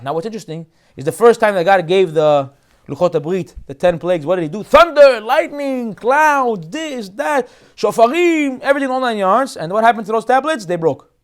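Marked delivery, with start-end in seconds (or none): 4.6-9.6 high, booming dramatic list; loud and thunderous. Acted. Rabbi recreating the first tablets' giving, loud and thunderous. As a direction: call out each item like a thunderclap. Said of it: "everything is very loud".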